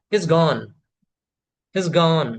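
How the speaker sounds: "he's gone" with "he's" run straight into "gone"; contraction demo. Shown as a demonstration. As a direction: model "he's gone" crisply, twice. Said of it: "he is और he has".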